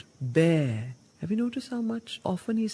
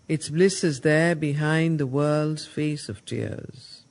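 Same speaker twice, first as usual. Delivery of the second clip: solemn recitation; 3 semitones lower, 4 dB louder than usual. Acted. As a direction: intone it like a warning from scripture. Reading Savitri verse.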